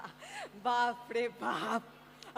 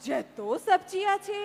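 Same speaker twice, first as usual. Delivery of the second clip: high, loud, stretched-out astonishment; can't believe the list, draw it out; shocked disbelief; 7 semitones higher, 6 dB louder, much slower than usual. Acted. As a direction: maid aghast at buying every vegetable. Maid shocked at shopping list.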